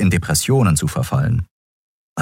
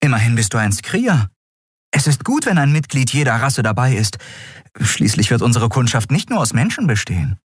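higher-pitched, lightly voiced character; lightly acted earnest persuasion, pitched up; Endriel, persuading; 7 semitones higher than usual. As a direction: make your case in a higher character voice.

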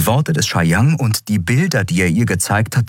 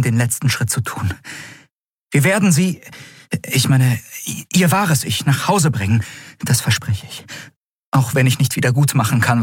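higher-pitched, earnest character voice; ernste Heldin, versprechend; 7 semitones higher than usual. Acted. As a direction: make a heartfelt promise in a higher voice. (höhere, eindringliche Frauenfigur, versprechend)

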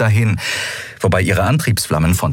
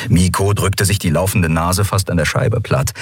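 plain reading voice

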